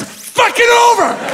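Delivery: sudden high shout; exasperated outburst. Retold or imitated. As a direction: blow up at the thought of it. Ranting climax about befriending rival player.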